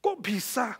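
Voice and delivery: acted shock, high and loud; startled. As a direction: blurt it out as a stunned wife. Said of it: "Hah, kaget istrinya".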